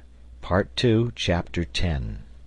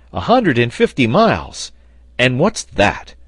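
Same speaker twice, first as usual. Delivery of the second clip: high, loud, dismissive echo of the distance; hearty raised-voice bravado; scoffing exclamation; 6 semitones higher, 6 dB louder than usual. A narrator's reading. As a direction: toss it back as if it were nothing.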